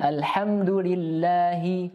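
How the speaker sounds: "Alhamdulillahi" chanted slowly, doubled l held; slow recited "Alhamdulillahi"; tajwid recitation. Shown as a demonstration. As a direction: stretch "Alhamdulillahi", hold the double l. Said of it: "tasydid di sini diperhatikan".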